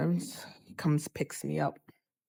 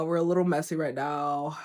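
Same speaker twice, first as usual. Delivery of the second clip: ordinary talk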